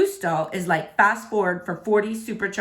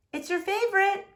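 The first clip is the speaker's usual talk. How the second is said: lightly raised mom voice; a hint of a caring mom; mom's voice; 9 semitones higher, 3 dB quieter than usual. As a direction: voice the mother gently asking. Mom voiced coaxing her son.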